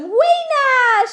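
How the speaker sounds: put-on high excited child's yell. A narrator's reading. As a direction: shout her name across the yard. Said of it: "she yelled".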